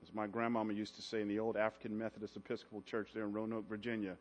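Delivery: low, soft, intimate preaching voice; hushed sermon close. Real, not acted. Preacher softly reassuring the congregation, hushed and low. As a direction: draw the church in close and quiet.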